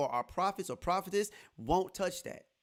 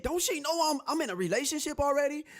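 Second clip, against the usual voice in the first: higher-pitched retold indignation; indignant comeback, pitched up; hot, indignant; 9 semitones higher, 4 dB louder than usual. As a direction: protest it, mildly worked up. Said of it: "I was hot".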